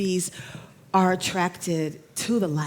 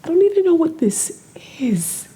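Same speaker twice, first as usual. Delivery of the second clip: tearful whisper; 9 semitones higher, 3 dB louder than usual. Real, not acted. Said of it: "I almost cried again".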